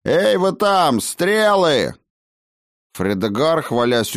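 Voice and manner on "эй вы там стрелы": high, loud summons over a distance; calling out. Acted. Master calling for arrows against intruders, shouting. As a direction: holler an urgent order to the archers.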